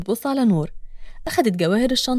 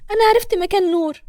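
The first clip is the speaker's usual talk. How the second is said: Hana's voice; 7 semitones higher, 4 dB louder than usual. Acted. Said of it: "وبتقول".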